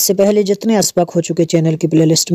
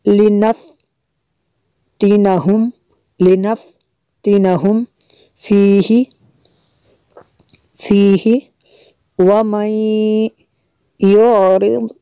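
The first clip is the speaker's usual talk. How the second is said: slow recitation; 3 semitones higher, much slower than usual. Shown as a demonstration. Echo recitation drill.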